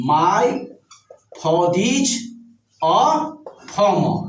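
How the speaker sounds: "father is a farmer" with r's dropped, word by word; silent r, linked. Shown as a demonstration. Teacher demonstrates phonetic English, father and farmer without r, slow. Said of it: "ऐसे हम बोलेंगे".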